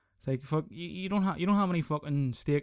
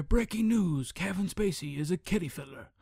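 mock news anchor, higher on breaking news; newsreader voice; 4 semitones higher than usual. Mocking the scandal as a breaking news headline. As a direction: announce it like a TV headline. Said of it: "I'm not breaking news here".

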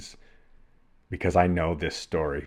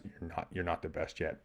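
softer, lower-key inner doubt; retold self-doubt; 3 semitones lower, 12 dB quieter than usual. Athlete arguing with himself in the mirror, doubting. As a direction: quietly correct yourself, the doubting side. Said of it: "this little dialogue just going back and forth".

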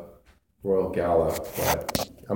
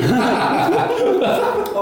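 loud squealing laugh; high burst of laughter; 6 dB louder than usual. Real, not acted. Bland apple verdict.

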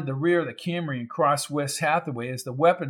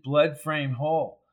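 usual speaking voice

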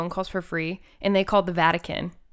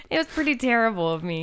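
ordinary talk

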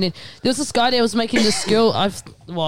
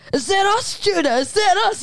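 retold yelling out; 9 semitones higher than usual. Girl outside the clubs calling to Judah, mock yell. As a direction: imitate someone calling out, pitched up. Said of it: "like, yelling out that stuff".

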